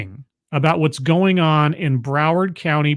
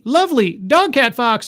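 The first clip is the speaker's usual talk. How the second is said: excited shout; 6 semitones higher than usual. Real, not acted. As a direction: yell out dog cat fox happily. Shouted name of the gift.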